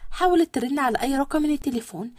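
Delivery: plain reading voice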